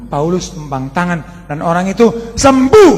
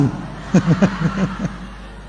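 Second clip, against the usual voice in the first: airy laugh. Real, not acted. Preacher chuckles at his snoring wife. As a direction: laugh without voice.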